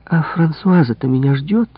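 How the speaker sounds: suddenly remember someone is waiting; exclaiming. A narrator's reading. Maid remembers Françoise is waiting, exclaiming. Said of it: "воскликнула она".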